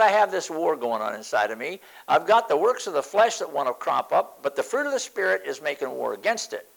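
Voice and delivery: normal talking voice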